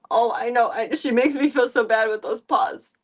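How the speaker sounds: ordinary talk, nothing marked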